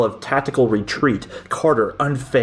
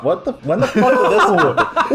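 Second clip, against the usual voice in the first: shocked disbelief; 8 semitones higher than usual. Real, not acted. Surprised by a twist.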